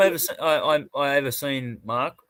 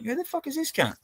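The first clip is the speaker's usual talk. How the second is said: very high, softer baffled question; retold incredulity; 12 semitones higher, 7 dB quieter than usual. Recalling someone talking shit about him, high and incredulous. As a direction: squeak it out in mock disbelief. Retelling reaction to trash talk.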